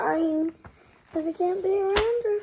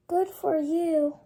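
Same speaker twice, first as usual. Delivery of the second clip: usual speaking voice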